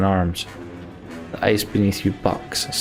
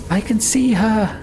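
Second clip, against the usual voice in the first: high, stretched-out cry; high excited holler; shout; slower than usual. A narrator's reading. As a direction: shout the sighting to the others. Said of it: "Avon shouts".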